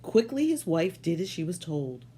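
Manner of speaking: plain reading voice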